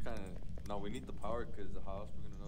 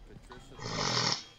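spooky ghost call; 12 semitones lower, slower than usual. Acted. Calling the ghost's name.

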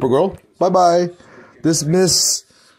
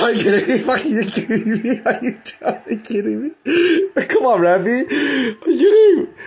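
tag laughing disbelief; 5 semitones higher than usual. Real, not acted. Incredulous, breaking up over repeated question.

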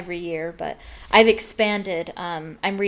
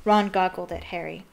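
usual speaking voice

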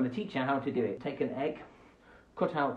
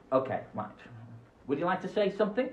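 usual speaking voice

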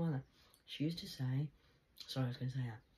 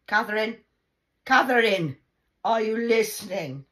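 loud, high calling Catherine; 4 semitones higher, 14 dB louder than usual. Retold or imitated. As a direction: yell her name to get attention. Grandma calling for attention.

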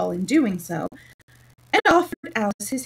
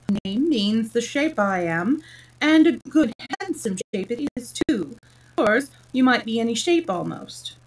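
plain reading voice

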